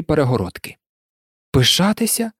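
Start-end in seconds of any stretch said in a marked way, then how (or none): 1.5-2.3 "Пишатися?" high and questioning; incredulous echo question. Acted. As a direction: throw the word back in disbelief. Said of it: "перепитав Гаррі". (здивовано перепитуючи)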